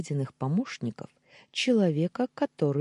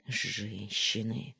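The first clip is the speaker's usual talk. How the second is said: slow, soft exasperated grumble; grumbling; 4 semitones lower, 4 dB quieter, much slower than usual. A narrator's reading. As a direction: mutter it with a sigh. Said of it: "проворчал Даниил".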